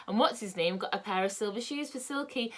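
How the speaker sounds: usual speaking voice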